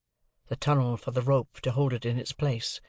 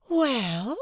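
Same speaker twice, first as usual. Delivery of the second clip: higher, rising questioning well; a lightly raised mock-innocent query; wide-eyed Estella; 11 semitones higher, 3 dB louder than usual. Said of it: "she opened her lovely eyes the wider".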